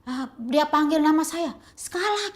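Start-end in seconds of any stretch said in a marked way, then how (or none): 1.7-2.3 high, rough calling of a name; imitating an angry employer calling the maid; harsh call. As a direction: bark the name out roughly and much higher. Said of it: "gitu, kasar".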